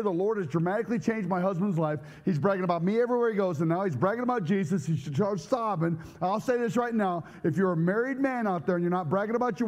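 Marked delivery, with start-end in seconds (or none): none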